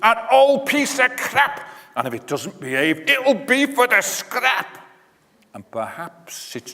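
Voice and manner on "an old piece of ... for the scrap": high, loud cursing at the van; cursing threat. Acted. Sam curses his stalled van, high and loud. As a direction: threaten the van like it can hear you. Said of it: "he curses and mutters".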